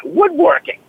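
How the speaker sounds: "Woodworking" lifted high in disbelief, then dropping; amazed emphasis. Real, not acted. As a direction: marvel at the word "Woodworking". Repeats the word to stress it.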